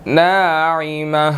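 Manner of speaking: slow, drawn-out tajweed recitation; slow Quranic recitation. Shown as a demonstration. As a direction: chant each word slowly. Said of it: "teacher recites the verse".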